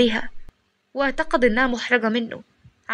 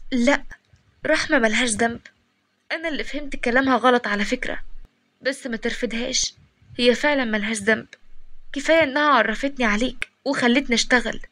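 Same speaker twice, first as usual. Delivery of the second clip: plain reading voice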